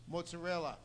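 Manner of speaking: usual speaking voice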